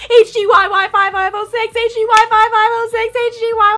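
high, loud, rushed chant of letters; frantic repeating. Retold or imitated. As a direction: drill the plate into memory. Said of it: "I'm, like, repeating the license plate".

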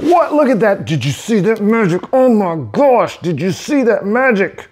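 high, excited showing off; delighted wonder at magic; thrilled. Real, not acted. Excited by marker effect.

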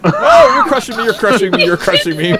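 high, loud squeezed cry; being squeezed hard. Real, not acted. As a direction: cry out as if crushed. Ship crushing his in game.

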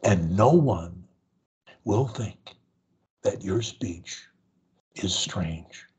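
low voice in small slow chunks; short increments. Shown as a demonstration. Coach modelling easy speech for a stutterer, short slow phrases. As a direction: pause after every few words. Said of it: "as I am doing now".

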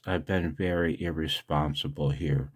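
usual speaking voice

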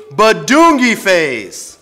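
high, loud, drawn-out nonsense; silly sounds. Acted. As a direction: bellow it slowly as a goofy finale. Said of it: "Make silly sounds like".

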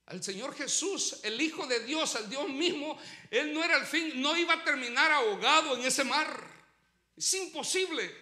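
loud, insistent pulpit preaching; impassioned preaching. Real, not acted. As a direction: preach it out loud, building to a forceful peak. Preaches at full force.

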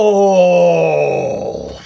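loud high cry of surprise; surprised cry. Real, not acted. Opener crying out at a surprise pull. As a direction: gasp out loud at the card.

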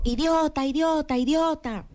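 playful imitation of a cave's echo. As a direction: sound like the cave bouncing it back. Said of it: "el eco de la caverna le contestó".

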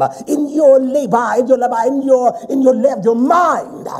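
impassioned, high-pitched sermon cadence; forceful preaching. Acted. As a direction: drive the warning home to the congregation.

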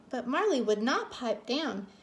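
plain reading voice

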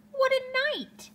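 squeaky high little mole line. A narrator's reading. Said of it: "Mole mutters".

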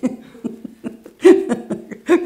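wheezing, high unvoiced laughter; high breathless laugh. Real, not acted. Preacher laughing at herself not knowing USB, high breathless laugh. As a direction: burst into a breathless giggle. Laughing at her own ignorance.

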